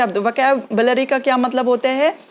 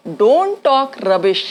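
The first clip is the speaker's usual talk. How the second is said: slow scolding demo; much slower than usual. Shown as a demonstration. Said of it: "तो क्या बोलेंगे".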